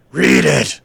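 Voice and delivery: loud gravelly order; barked command. Acted. Tattooed attacker orders the desk girl to read, commanding. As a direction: bark it as an order. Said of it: "Berkey commanded".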